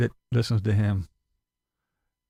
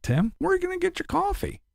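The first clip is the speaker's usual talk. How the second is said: ordinary talk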